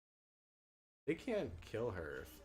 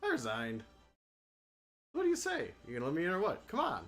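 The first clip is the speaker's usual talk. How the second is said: put-on bright heroic girl, higher pitch; high knight girl voice; 5 semitones higher, 3 dB louder, much faster than usual. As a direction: play the eager young knight. Voicing Marsha persuading.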